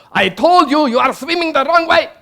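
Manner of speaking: loud, high furious yell; angry shout. Retold or imitated. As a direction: yell it at the swimmer in a rage. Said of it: "screaming at my brother".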